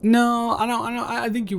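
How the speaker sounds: flustered denial. Acted. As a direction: squeak it like you've been caught. Denying he said pretty.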